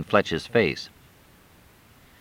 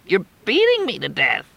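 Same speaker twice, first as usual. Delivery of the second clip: mild strangled protest; 13 semitones higher than usual. Acted. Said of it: "sounded like she was strangling".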